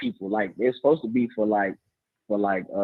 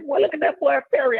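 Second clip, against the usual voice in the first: calling out. Retold or imitated. Calling out to Perry at the game.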